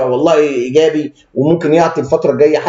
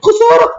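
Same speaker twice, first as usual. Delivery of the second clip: mock panicked wail; 4 dB louder than usual. Mock panic over stock loss.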